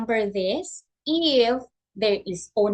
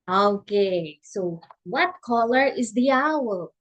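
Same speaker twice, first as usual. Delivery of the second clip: ordinary delivery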